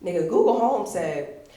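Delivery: ordinary delivery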